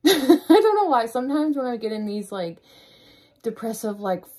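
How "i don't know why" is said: laughing. Real, not acted. Laughing at herself.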